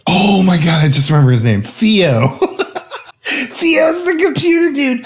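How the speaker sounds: high laughing voice. Real, not acted. Remembering the name, words breaking into high laughs. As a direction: laugh through a sudden memory. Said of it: "laughing while recalling".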